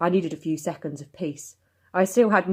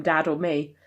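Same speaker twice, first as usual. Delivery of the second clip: ordinary delivery, nothing marked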